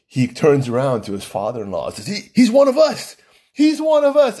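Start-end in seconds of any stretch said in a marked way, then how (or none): 2.1-4.4 high, thrilled, imitating the groom; excited announcement. Groom tells his father-in-law about the podcaster, excited high voice. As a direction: proudly announce he's one of us.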